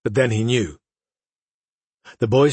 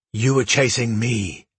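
usual speaking voice

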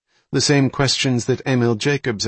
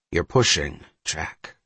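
lower, understated warning; 4 semitones lower, slower than usual. A narrator's reading. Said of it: "Cantor warned".

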